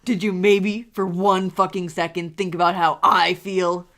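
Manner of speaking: loud angry outburst. Acted. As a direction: shout it at him, slow and furious. Roy's angry outburst in fanfic reading.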